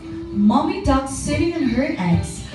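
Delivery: ordinary delivery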